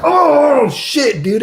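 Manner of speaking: high, loud yelp of shock, then shit; startled oh shit. Retold or imitated. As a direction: jump up into a cry of surprise. Shocked by the unexpected outburst.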